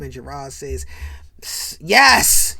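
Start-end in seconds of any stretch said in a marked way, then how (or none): 1.4-2.6 loud, higher mock-angry yes; mad. Gerard mad about his pops' jacket, loud angry answer. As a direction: spit out an acted, fed-up yes. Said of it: "You know, he's mad, right?".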